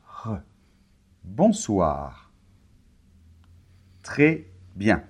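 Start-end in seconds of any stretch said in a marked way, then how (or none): 0.0-0.4 "re." with a soft French throat R, quietly; final R demo. Shown as a demonstration. Teacher showing a learner the final R in "re.". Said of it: "Et le R final". 1.2-2.3 "Bonsoir." said clearly, R at the back of the throat; careful model "Bonsoir."; model word. Shown as a demonstration. Teacher modelling "Bonsoir." for a learner. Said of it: "Et le R final".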